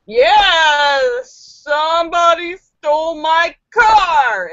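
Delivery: put-on loud, slow, slurring drunk; drunk man. Drunk Edward telling the cop about his car, loud and slow. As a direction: shout it like a sloppy drunk.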